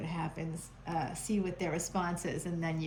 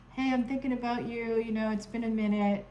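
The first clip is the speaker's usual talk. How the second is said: lightly mocking, a bit higher and casual; breezy ghoster text; 4 semitones higher than usual. Reader mocks a returning ghoster's casual text. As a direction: play the ghoster acting like nothing happened. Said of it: "as if nothing has happened".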